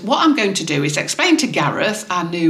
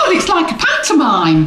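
excited gasp; 9 semitones higher, 4 dB louder than usual. Real, not acted. Gasps in delight.

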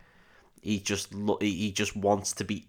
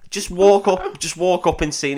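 loud, high mock stage patter; acted calling out; 7 semitones higher, 6 dB louder than usual. Drummer taking over the gig in front of everyone, calling out. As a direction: belt it out like a performer.

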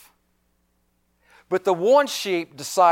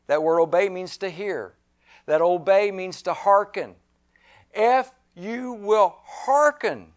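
ordinary talk, nothing marked

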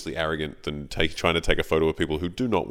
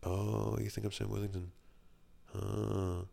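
quiet inner reply; 3 semitones higher, 9 dB quieter than usual. Retold or imitated. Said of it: "I'm like".

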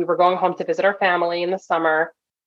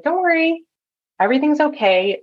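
high, sing-song nurse reassurance; calm Nurse Rachel voice; 10 semitones higher than usual. Retold or imitated. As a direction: soothe an upset passenger in a raised, placating voice. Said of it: "I put on my calm Nurse Rachel voice".

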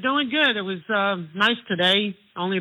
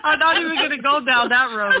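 laughing; 8 semitones higher than usual. Real, not acted. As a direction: laugh while declining the topic. Caller refuses, laughing.

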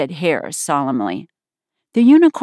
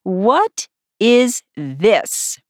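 very slow, higher, appalled question; deep disgust; 5 semitones higher, much slower than usual. A narrator's reading. Unicorn staring at Alice, deeply disgusted. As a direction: draw each word out in disgust. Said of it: "with an air of the deepest disgust".

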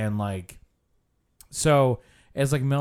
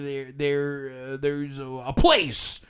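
mock stammer, stuck on each word; imitating a halting tour guide; stuttering instructor. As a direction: struggle towards the word, stuttering. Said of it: "this instructor who stutters a lot".

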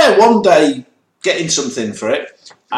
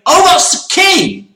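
high, slow mock discovery; sudden realisation; 11 semitones higher, 3 dB louder, slower than usual. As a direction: say it like it finally clicked.

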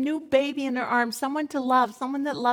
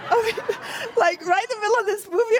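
high, fast, laughing through the words; 10 semitones higher, faster than usual. Real, not acted. Wife laughing to audience about husband's timing, high laughter. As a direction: giggle it out, amazed.

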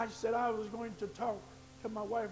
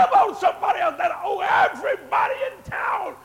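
preaching shout; 7 semitones higher, 16 dB louder than usual. Acted. Pastor challenging the comfortable to care for the debtors, shouting. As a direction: press it on the comfortable ones.

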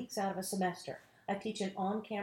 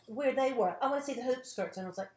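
ordinary talk, nothing marked

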